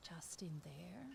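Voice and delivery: hushed whisper, trailing off; whispering to herself while building. Real, not acted. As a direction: breathe it like a secret. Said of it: "I don't know why I'm whispering".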